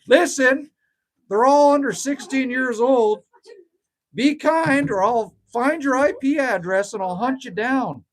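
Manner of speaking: loud, half-joking protective dad warning; mock threat. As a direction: lean in and warn the chat, only half serious. Warns chat to be kind.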